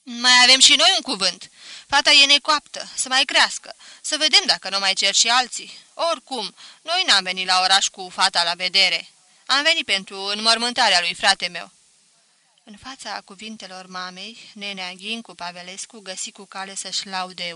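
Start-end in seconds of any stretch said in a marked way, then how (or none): 0.0-11.7 mother cutting in sharply, much louder; sharp, firm mother's voice; mother sharply refusing the match; sharp-voiced mother. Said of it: "cu glas ascuțit".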